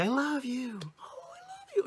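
very high, cooing adoration; baby-talk gushing. Shown as a demonstration. Speaker shows how you talk to babies, cooing. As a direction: coo it high, then thin to a squeal. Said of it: "when you see little babies".

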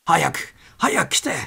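frantic high call; shouting. A narrator's reading. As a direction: yell for help across the cabin. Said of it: "と叫んだ".

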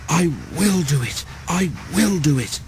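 lightly acted panting; panting determination. A narrator's reading. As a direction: push each phrase out with effort. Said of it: "he panted".

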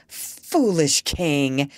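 very slow, drawn-out put-on voice; Doctor Dolittle, scornful. A narrator's reading. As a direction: address a fool grandly and slowly. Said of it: "just the way the doctor would".

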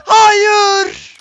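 real anguished scream. Real, not acted. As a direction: scream it as you lose. Losing fight, screaming.

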